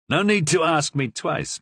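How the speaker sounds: usual speaking voice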